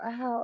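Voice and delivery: soft, high yelp of ow; a light high ow like girls in the club; girls' ow. Retold or imitated. Mimicking girls in the club, soft high ow. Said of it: "the girls go".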